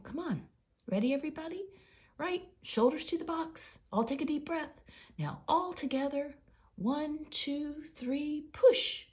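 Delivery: high, eager helper voice; cheery little painter voice. Acted. Peter Painter rallies the animals.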